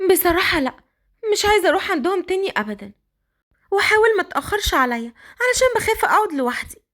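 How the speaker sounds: put-on Qadr, high girlish voice. The girl complaining to Raad his clothes don't fit, high girlish voice. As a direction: speak up high like a shy young girl.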